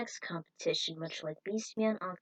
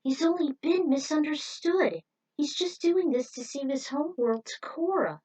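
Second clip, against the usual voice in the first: higher, louder, earnest plea; pleading defence; 5 semitones higher, 5 dB louder than usual. Acted. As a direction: beg them to understand him. Voicing Tinsel pleading for Octoman.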